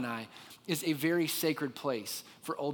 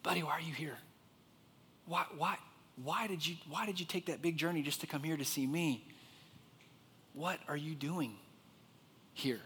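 hushed, soft coaxing; soft, intimate questioning with long pauses; God speaking gently; 9 dB quieter than usual. Acted. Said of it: "It's almost like God said".

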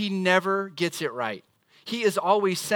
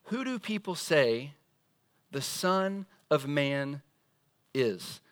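slow question; 4 semitones lower, slower than usual. Retold or imitated. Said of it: "he asks his disciples this question".

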